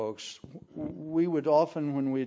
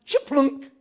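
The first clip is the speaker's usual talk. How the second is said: high, loud mock chiplunk sound; ball swish; 12 semitones higher, 9 dB louder than usual. The ball dropping through the hoop, sounded out high. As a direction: make the basket sound.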